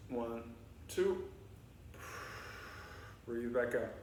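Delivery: soft, low, hushed cool-down voice; guided breathing. Acted. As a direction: ease the kids into calm breaths. Leads calming breathing cool-down.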